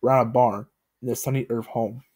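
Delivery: ordinary delivery, nothing marked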